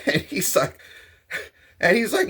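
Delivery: ordinary talk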